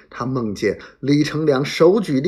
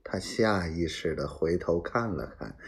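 usual speaking voice

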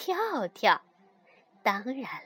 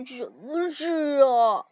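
sleepy mumble; 4 dB louder, slower than usual. A narrator's reading. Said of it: "跳跳咕哝着说".

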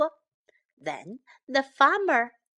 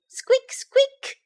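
very high, loud mouse squeaks; squeaking mouse; 13 semitones higher, 6 dB louder than usual. A narrator's reading. Mouse running up to the farmer, squeaking. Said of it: "老鼠吱吱地叫了起来".

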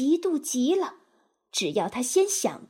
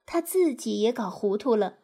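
ordinary delivery

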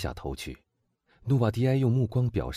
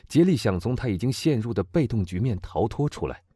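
ordinary delivery, nothing marked

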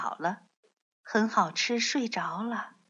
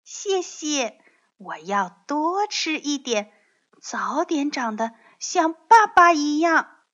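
put-on high child voice, building louder to the end; grateful baby dinosaur; 6 semitones higher, 4 dB louder than usual. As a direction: thank daddy as an eager little dinosaur.

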